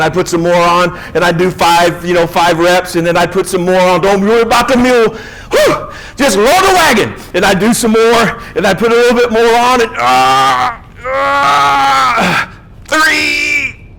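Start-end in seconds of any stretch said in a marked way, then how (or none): 4.0-7.1 higher, effortful gasping mantra; straining under weight. Acted. Straining under heavy bench weight. 11.1-13.9 higher, pained crying strain; straining groan. Acted. Straining through a heavy lift.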